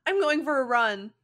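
high, loud, whiny panic; Ino panicking. Acted. Ino panics about her weight to Shikamaru, high loud whine. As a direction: freak out, voice cracking.

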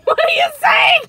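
high loud shout. Real, not acted. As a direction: yell over the headphones. Headphones on, can't hear.

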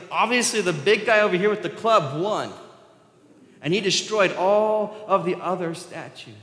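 high, loud, cheeky matter-of-fact; Abraham cheekily blaming the biggest idol; mock obvious. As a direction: sell the absurd story with a straight face. Abraham's sly mock explanation.

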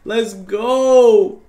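high, loud, stretched celebration; triumphant cheer. Real, not acted. Celebrating a play.

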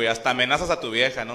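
ordinary talk, nothing marked

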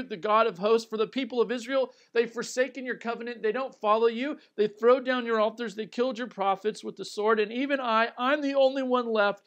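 high, loud and urgent complaint. Acted. Elijah loudly pleading his complaint to God. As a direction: pour out the grievance full force.

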